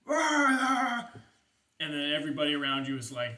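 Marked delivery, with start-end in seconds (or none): 0.1-1.0 loud high battle roar; bellowing orc war cry. Acted. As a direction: roar it like a big orc warlord rallying troops. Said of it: "and he is just like".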